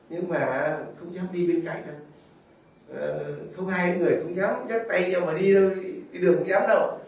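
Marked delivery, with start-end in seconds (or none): none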